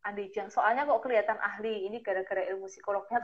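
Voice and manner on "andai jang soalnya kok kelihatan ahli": normal talking voice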